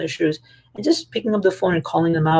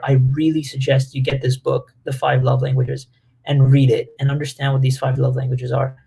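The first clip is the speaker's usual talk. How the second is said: normal talking voice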